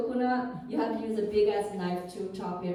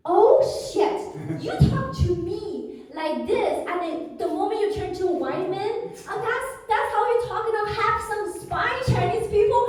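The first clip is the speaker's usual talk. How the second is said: furious outburst; 8 semitones higher than usual. Retold or imitated. She furiously confronts the vendor for treating her worse than her husband. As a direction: let the rage boil over. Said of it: "I was so mad".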